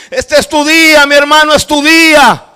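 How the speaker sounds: high, loud jubilant proclamation; retold shouting. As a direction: shout it across to a brother. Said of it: "Empieza a gritar y a cantar".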